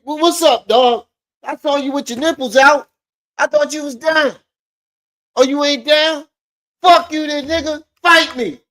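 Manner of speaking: high, loud hammed-up angry rapper; mocking imitation. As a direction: whine it up an octave with fire.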